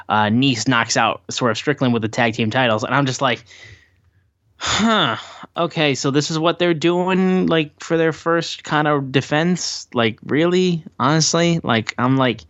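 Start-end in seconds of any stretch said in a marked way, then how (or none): 4.6-11.6 higher-pitched, underwhelmed questioning; puzzled disbelief. Retold or imitated. Fan reacting to a first title defense, puzzled disbelief. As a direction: act out a sceptical double take.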